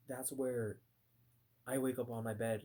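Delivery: usual speaking voice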